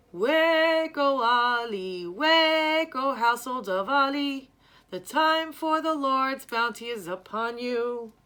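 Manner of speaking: loud, high chanted call at the door. Acted. Drummer calling Ali's household at the door, loud and high. Musaharati calls father by name.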